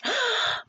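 a quick, high startled gasp; sharp gasp. A narrator's reading. Nona hears the emperor's request, gasps. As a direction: gasp in shock. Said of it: "Nona gasped".

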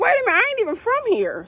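high and fast, an incredulous wait a minute; retold disbelief. Reading the ticket in the office, high-pitched fast shock. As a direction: replay the jolt of seeing Newark on the ticket. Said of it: "I was like".

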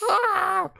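high-pitched wailing scream; frustrated scream. Real, not acted. Player who lost to Chester, frustrated scream. Venting after being caught.

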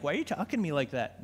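quick, quiet, hurt little retort; a hammed-up hint of a wounded wife; mock wife voice. Pastor jokingly voices his wife's hurt reply, quick and soft. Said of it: "she doesn't talk like that".

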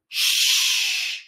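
long, strong shushing; hushing shh. Acted. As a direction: shush someone who is too noisy. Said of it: "when you want someone to be quiet".

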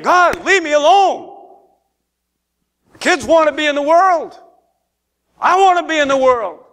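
high-pitched protest, rising in pitch; mock complaining. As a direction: imitate a believer griping at God. Mimics congregant complaining to God.

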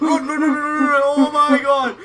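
frantic yell, high and loud; panicked shouting. Real, not acted. Fleeing the monster.

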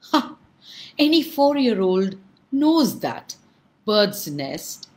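loud, high, scoffing laughing retort; mocking laugh. Governor mocking the monk's simple advice, laughing loudly. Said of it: "laughed the governor".

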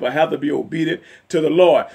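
ordinary talk

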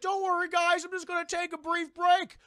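loud, high-pitched mock-cheerful reassurance; carefree doomed guy. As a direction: call it out like a hammy movie victim. Acting doomed character reassuring friends.

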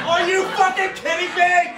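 high, loud disbelieving shout; exasperated yell. Retold or imitated. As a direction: yell in frustration at the knocking.

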